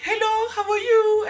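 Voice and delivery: high, loud singsong greeting; friendly shop greeting. Retold or imitated. Shop staff greeting a customer, high loud singsong hello. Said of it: "people are gonna be like".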